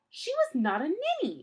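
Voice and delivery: high, loud protest; indignant cry. A narrator's reading. Klaus protesting to Count Olaf, high loud cry. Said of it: "Klaus cried".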